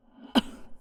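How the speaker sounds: sudden breathy cough; sharp cough. Acted. Stabbed immortal breaks off with a sharp cough. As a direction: cough hard, as if wounded.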